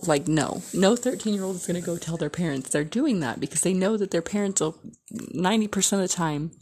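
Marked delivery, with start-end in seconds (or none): none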